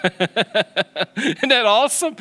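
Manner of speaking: slow, delighted laugh through the words. Real, not acted. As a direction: laugh with joy at the line. Delighted laugh after quote.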